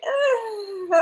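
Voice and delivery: high, soft hesitant groan; reluctant hesitation. Retold or imitated. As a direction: imitate a doubtful, drawn-out hum. Acting Garcelle's reluctant reaction.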